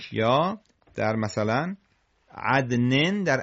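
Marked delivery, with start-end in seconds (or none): none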